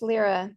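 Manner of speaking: Lyra said as LEER-uh; Lyra with an ee sound. Shown as a demonstration. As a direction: correct the name to LEER-uh for Lyra. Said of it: "is it Lyra or Lyra?".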